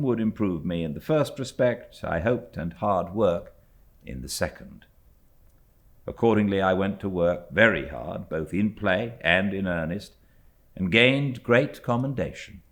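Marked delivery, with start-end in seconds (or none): none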